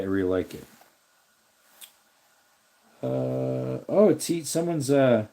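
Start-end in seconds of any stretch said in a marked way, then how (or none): none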